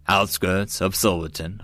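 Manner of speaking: ordinary delivery, nothing marked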